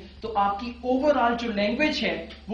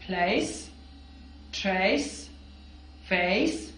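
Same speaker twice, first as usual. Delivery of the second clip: clear drill words; 3 semitones lower, 3 dB quieter, much slower than usual. Shown as a demonstration. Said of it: "सी की sound एस की तरह".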